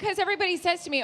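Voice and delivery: rushed and quick; fast. Real, not acted. Rushing to explain why everyone recognizes her voice, fast.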